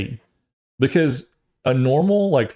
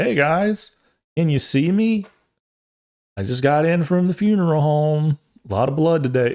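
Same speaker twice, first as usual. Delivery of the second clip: high, chirpy YouTuber greeting; a hammed-up vlogger hello to the camera; mock streamer voice; 5 semitones higher than usual. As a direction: play a cheery streamer saying hi.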